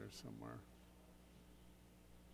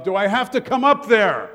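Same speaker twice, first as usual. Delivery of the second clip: loud, higher call up the stairs as a fed-up dad; dad yelling upstairs; 5 semitones higher, 6 dB louder than usual. Retold or imitated. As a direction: yell it up to the kids' bedroom. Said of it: "your dad might yell up".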